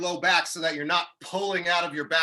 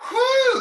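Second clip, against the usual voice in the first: loud exhale after exertion; big winded whew; 6 dB louder than usual. Real, not acted. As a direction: blow out hard. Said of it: "that one got me going".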